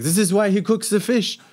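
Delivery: high, dismissive French chef voice; French accent, disdainful. Retold or imitated. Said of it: "in his French accent".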